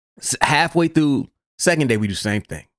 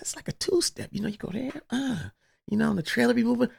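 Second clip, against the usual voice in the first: high, soft whisper; whispered advice; 10 semitones higher, 9 dB quieter, faster than usual. Retold or imitated. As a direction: whisper it, pitch up a little. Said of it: "I said".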